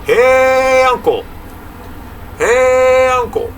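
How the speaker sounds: long, high, loud holler on HEY; hollered call. Acted. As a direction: call across a great distance, vowel held out. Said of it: "hollers out".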